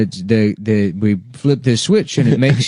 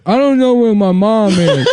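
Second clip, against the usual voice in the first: high, childish put-on voice; hammed-up childish accent; fake childlike voice; 9 semitones higher than usual. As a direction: play a lost little kid. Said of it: "I don't know what kind of accent that is".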